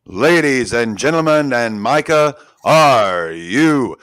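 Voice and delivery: stretched, loud boxing announcer call; ring announcer. Acted. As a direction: hype them up before the bout.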